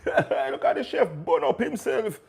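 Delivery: high, slow words through laughter; laughing mock viewer. Mocking the chef burning himself, laughing through it.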